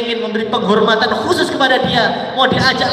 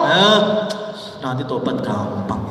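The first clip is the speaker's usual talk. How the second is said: much lower, softer offhand reply; dismissive shrug; 10 semitones lower, 4 dB quieter than usual. Retold or imitated. The careless man waving off the warning, low and offhand. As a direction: brush it off lazily.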